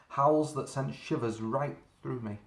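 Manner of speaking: plain reading voice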